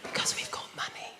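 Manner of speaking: hushed, unvoiced whisper. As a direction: whisper it like a secret. Joke about quiet middle class.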